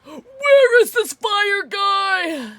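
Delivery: high, loud, drawn-out wail; frustrated wail. Real, not acted. Player searching in vain for the fire key, wailing loudly. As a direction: yell it slowly, stretching out each word.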